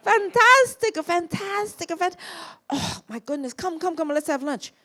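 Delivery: high, loud Italian gushing; thrilled Italian director inviting to lunch; Italian accent. Retold or imitated. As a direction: sing out fantastico like an Italian. Fellini exclaims.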